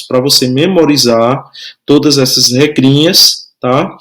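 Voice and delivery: ordinary delivery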